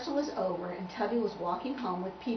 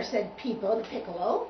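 plain reading voice

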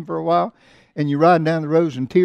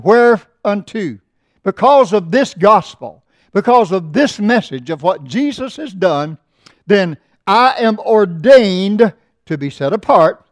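loud, slow, ringing pulpit reading; preaching the text at full voice; scripture reading; 3 semitones higher, 6 dB louder, slower than usual. Acted. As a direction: proclaim the verse to the congregation.

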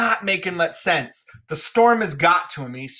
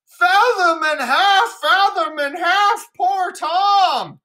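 much higher, slow, louder wailing cry; screaming Edgar; 13 semitones higher, 5 dB louder, much slower than usual. Acted. Edgar as poor Tom screaming from the hovel, high wail. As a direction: howl it out from the hovel. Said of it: "he screams out".

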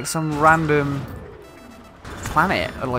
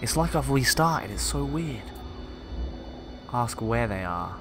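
calm reading of a log; story narration; 4 semitones lower, 5 dB quieter than usual. Acted. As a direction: read it like a captain's journal. Reads game log aloud.

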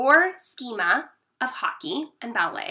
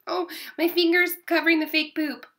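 ordinary delivery, nothing marked

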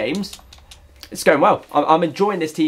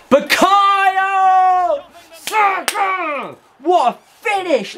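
very high, drawn-out goal yell; long high commentator roar; goal celebration shout; much slower than usual. Real, not acted. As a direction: scream the scorer's name.

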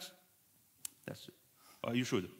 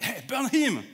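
angry mob leader; 8 semitones higher, 5 dB louder, slower than usual. Retold or imitated. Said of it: "Yeah, you can tell it".

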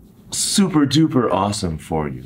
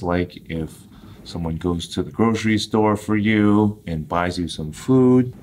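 ordinary delivery

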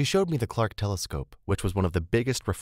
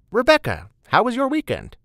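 very high, loud, teasing hello; bright greeting; 7 dB louder than usual. Acted. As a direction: call out to a colleague with a smile. Beth greets colleague, hint of smile.

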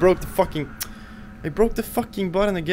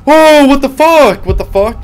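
loud startled shouting; jolted, yelling in fright; scared horror game streamer; 11 semitones higher, 10 dB louder than usual. Real, not acted.

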